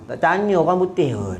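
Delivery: usual speaking voice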